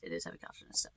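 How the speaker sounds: soft unvoiced aside; whispering. Real, not acted. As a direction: whisper it to yourself.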